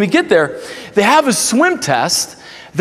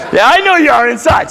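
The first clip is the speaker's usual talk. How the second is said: playful shout; 6 semitones higher, 4 dB louder than usual. Real, not acted.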